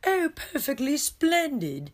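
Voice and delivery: hammed-up posh British accent, high and drawn out; mock a prim British kid in an exaggerated British accent; bad British accent. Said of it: "I'm terrible at accents".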